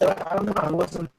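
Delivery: usual speaking voice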